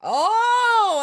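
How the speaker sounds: high, loud delighted oh; light up at the question; excited oh. Real, not acted.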